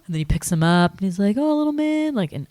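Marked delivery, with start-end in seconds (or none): 1.4-2.1 tender mock coo. Scrooge to child, tender.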